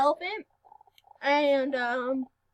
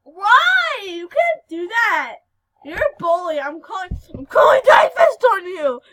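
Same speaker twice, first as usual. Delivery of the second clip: mock whiny protest; 5 semitones higher, 12 dB louder, slower than usual. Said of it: "I'm just like".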